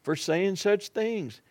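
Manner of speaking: ordinary talk, nothing marked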